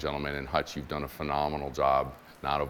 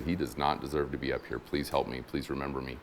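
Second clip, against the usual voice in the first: soft, lowered voice, humbly asking; quiet pleading; 4 semitones lower, 7 dB quieter than usual. Acted. Condemned thief on the cross to Jesus, quiet pleading. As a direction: plead gently, getting softer toward the end.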